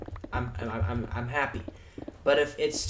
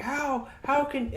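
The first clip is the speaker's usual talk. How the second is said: much higher, slowed, stammering disbelief; mock shocked villain; slower than usual. The bad guy asking how he uses magic, shocked. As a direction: play the stunned bad guy. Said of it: "and he's like".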